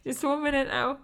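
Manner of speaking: tearful apology. Retold or imitated. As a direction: beg a moment while tears come. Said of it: "I was like".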